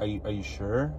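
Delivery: hushed doubtful asking; awkward and quiet. Retold or imitated. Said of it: "so awkward on the phone".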